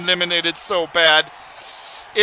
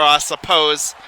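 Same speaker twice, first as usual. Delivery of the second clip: ordinary talk, nothing marked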